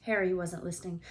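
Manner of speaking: ordinary delivery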